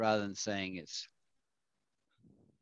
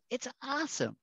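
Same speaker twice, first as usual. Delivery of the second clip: much higher gushing awesome; gushing American. Retold or imitated. Teacher mimics an American overstating, gushing. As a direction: hit it way up high, over the top. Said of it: "That would be the Americans".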